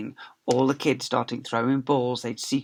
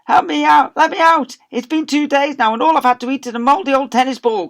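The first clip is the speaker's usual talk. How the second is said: loud, high pleading from inside the cupboard; trapped boy crying out through the door; 14 semitones higher, 12 dB louder than usual. Acted. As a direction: bang and beg to be let out. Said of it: "cried the boy".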